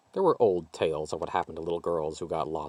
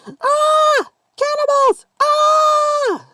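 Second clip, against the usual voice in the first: put-on very high, loud terrified child's shriek; piercing high child's screams; little girl shrieking; 14 dB louder, much slower than usual. A narrator's reading. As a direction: shriek in terror like a fleeing girl. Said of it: "the little girl shrieked".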